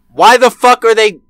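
ordinary talk, nothing marked

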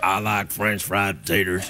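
very low, slow character voice; hammed-up slow, deep voice; put-on drawl.

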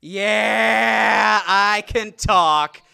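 loud, high, slow proud yell; triumphant shout. Retold or imitated. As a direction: draw it out loud and high like a big reveal. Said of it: "And he said".

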